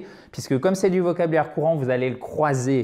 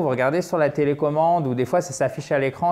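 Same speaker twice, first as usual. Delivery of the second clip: normal talking voice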